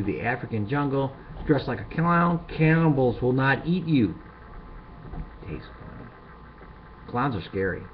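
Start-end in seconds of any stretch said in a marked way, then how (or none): 5.5-6.1 hushed deadpan whisper; whispered punchline. Pretend. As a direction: whisper "Tastes funny" very low and soft. Cannibal punchline aside.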